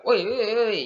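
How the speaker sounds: loud, high rude "oi, oi, oi"; mock rude holler; rude shout. Shown as a demonstration. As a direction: yell it rudely like a rough kid. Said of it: "Jangan cakap yang, yang kasar".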